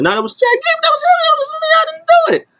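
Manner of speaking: squeaky, very high protest; mock panicked denial. [name] denying it to an angry Otis, squealing in mock panic. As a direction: plead innocence in an exaggerated falsetto.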